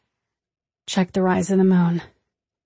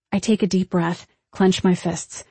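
ordinary delivery, nothing marked

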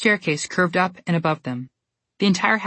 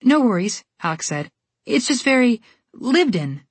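put-on polite girl; 6 semitones higher than usual.

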